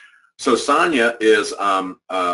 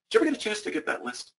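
high, soft, quick sweet questions; a sugary reminder to a colleague who never made the list; mock-innocent question; 11 semitones higher, 7 dB quieter, much faster than usual. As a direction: ask with fake innocence to needle her.

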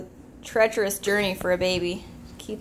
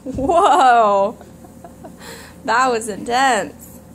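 stunned; 2 semitones higher, 9 dB louder, much slower than usual. Real, not acted. Baby's dramatic fall.

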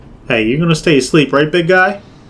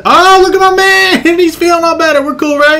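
very high, jumpy false cheer; squeal, then anxiously make friends with the threat; high-pitched appeasing chatter; nervous placating; 12 semitones higher, 4 dB louder than usual. Real, not acted. Monster reappears, he placates it.

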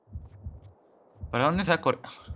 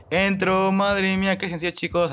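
excited relief; 7 semitones higher than usual. Real, not acted. To the viewers after clearing the room, excited and high. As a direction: burst out with delight.

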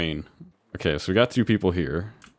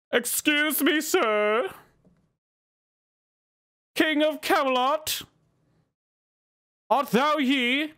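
much higher, slow mock-olde knight; much slower than usual. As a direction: ham up a courtly knight. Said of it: "I could be a knight".

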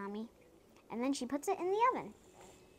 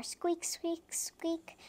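higher-pitched squeaks; squeaking toy car; 5 semitones higher, much slower than usual. A narrator's reading. Child reading baby's kitty car, high squeaks. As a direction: squeak it like little wheels. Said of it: "Baby rides the kitty car".

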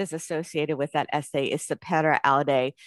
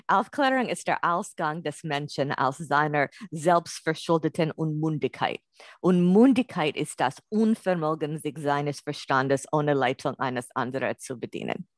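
German read with a non-native accent; bad German accent. Real, not acted. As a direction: read the German original in an imperfect foreign-sounding German accent. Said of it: "in my bad German accent".

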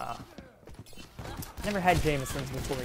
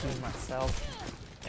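very slow "Redeem myself", rising at the end; slower, subdued echo of "Redeem myself"; slow repeat; 4 dB quieter, much slower than usual. Real, not acted. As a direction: draw out "Redeem myself" as an afterthought. Repeats the vow to himself.

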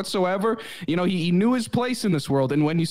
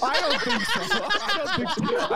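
high, laughing I don't think so; laughing scorn; 13 semitones higher, slower than usual. Real, not acted. Host laughing at a caller, high and mocking.

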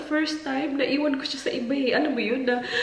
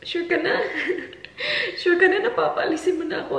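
inner voice, laughing; 6 semitones higher than usual. Retold or imitated. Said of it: "parang yung isip ko".

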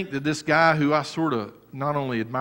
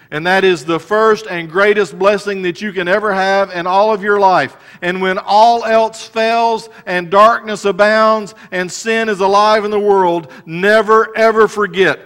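raised, loud preaching voice; full-force sermon delivery; fervent preaching; 5 semitones higher, 7 dB louder than usual. Acted. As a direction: preach it out to the congregation.

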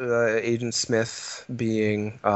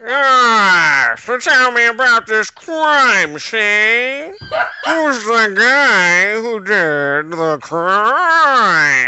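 put-on loud, high, slow gangster baby; Soda Baby voice; 8 semitones higher, 7 dB louder, much slower than usual. Soda Baby questioning the witness, loud slow gangster voice. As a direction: bark it out like a tough baby detective. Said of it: "Do it as Soda Baby".

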